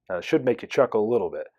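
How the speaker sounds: ordinary talk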